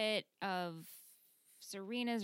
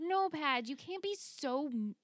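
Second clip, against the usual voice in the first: raised, higher-pitched telling-off; exasperated scolding; 5 semitones higher, slower than usual. Acted. Telling off Padge for his behavior, exasperated. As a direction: scold him in fed-up protest. Mock scolding a show character.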